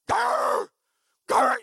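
loud, high snarl; imitated dog growl. Dog grabbing a boy's leg, loud snarling growl. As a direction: growl like a dog tugging hard.

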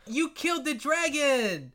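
high, loud, drawn-out triumph; kid game master. Acted. Kid game master announcing the dragon's defeat, high jubilant shout. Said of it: "the D M kid goes like".

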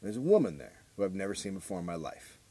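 ordinary talk

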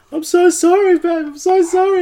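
mock-distraught high falsetto wail; hammed-up tearful apology, very high-pitched; crying, freaking-out partner. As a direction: imitate a panicked partner's loud, squeaky apology. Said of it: "and she's crying, and she's freaking out".

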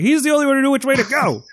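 high, fast mock exasperation; exasperated. Retelling the reaction to killing their only guide, exasperated. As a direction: protest incredulously, pitch climbing.

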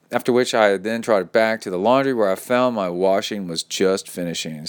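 ordinary talk, nothing marked

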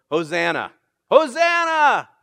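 loud, high cry of Hosanna; yelled Hosanna. Retold or imitated. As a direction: cry it out like a desperate plea. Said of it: "they yelled".

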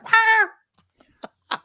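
shrill high metal shriek; high rock scream. Retold or imitated. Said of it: "She's like".

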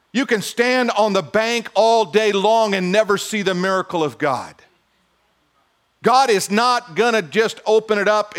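high, loud fired-up preaching; preaching at full force. Acted. Pastor preaching hard to the congregation, fired up. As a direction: lift the room, pastor in full flight.

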